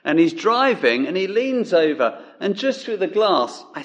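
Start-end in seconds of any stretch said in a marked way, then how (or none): none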